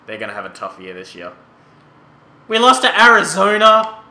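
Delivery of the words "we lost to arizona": loud, high outraged shout; frustrated yell. Real, not acted. Gamer yelling at the screen after losing to Arizona, outraged shout.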